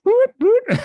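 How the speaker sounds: high, loud whooping siren noise; car alarm imitation. Telling about alarms on the ferry, loud high car alarm whoops. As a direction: mimic a car alarm wailing. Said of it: "And this one would go off".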